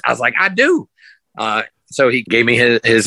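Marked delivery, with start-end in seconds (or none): 0.4-0.9 higher, keen yes; bright, eager confirmation; eager reply. Retold or imitated. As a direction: say it like you can't wait. Answering the producer eagerly.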